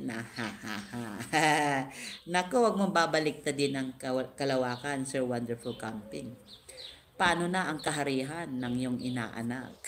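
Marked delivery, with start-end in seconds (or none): none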